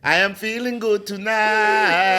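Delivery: put-on high, slow singsong African accent. Guest playfully sharing his good mood, African accent.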